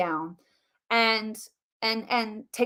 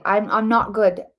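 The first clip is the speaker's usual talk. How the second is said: ordinary delivery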